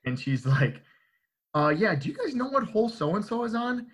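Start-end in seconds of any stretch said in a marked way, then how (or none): 1.5-3.9 much higher voice imitating a woman asking; kitchen employee. Kitchen employee asking the staff about a member, high-pitched query. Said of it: "a kitchen employee comes out".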